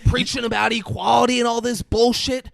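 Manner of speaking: loud, high angry rant; hammed-up furious yelling. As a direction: rant at him across the dinner table. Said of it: "He goes".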